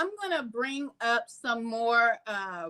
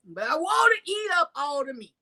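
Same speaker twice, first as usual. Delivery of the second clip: mocking greedy man, shouted; 3 semitones higher, 3 dB louder than usual.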